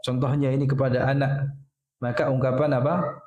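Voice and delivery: ordinary talk, nothing marked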